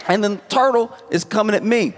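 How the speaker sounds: normal talking voice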